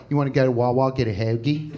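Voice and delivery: put-on low Philly accent; lightly voiced Philly dude. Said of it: "I heard one of them go, that accent".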